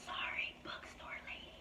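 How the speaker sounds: soft unvoiced whispered apology. Real, not acted. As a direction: whisper sorry so nobody is disturbed. Apologizing in the quiet section.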